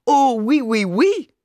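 high, loud hammed-up French queen; mock queen. A narrator's reading. Father mocks the mother's Frenchness, hammed-up high queen voice. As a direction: wave it off like a prissy queen. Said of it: "winkte er mit dem Tuch wie eine Königin".

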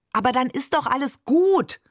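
put-on child flaring up at father over the sandwiches, hot-tempered. A narrator's reading. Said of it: "rief ich fast genauso aufbrausend".

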